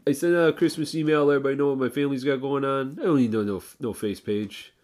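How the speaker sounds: high, loud put-on guy voice; Joey Meatballs. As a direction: brag loudly in a comic character voice. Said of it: "He sends out".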